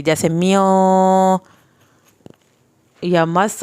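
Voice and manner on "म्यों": म्यों with a slow, long nasal hum; teacher demonstrating the nasal delay in म्यों; held nasal. Shown as a demonstration. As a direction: linger on the ghunna in म्यों. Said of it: "वहाँ पे थोड़ी सी देर लगेगी".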